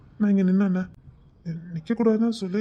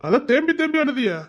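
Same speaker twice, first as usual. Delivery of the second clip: higher, louder sneering question; mocking taunt; 8 semitones higher, 4 dB louder than usual. Husband taunting the wife he overheard crying. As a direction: needle her for sobbing. Husband mocks her sobbing.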